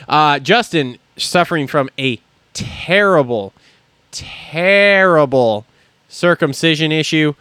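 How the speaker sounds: drawn-out mock grave voice; slow mock solemn. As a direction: stretch terrible out gravely.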